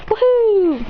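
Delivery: high, loud joyful woohoo; cheer. Acted. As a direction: whoop while leaping in the air.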